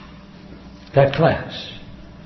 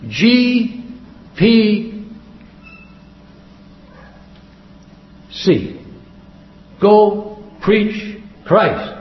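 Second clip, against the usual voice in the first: each letter and word held long, with pauses; slow, spaced-out retold revelation; drawn-out proclamation; 4 semitones higher, much slower than usual. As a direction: spell out the sign slowly, imitating the man. Reading out letters seen as a sign.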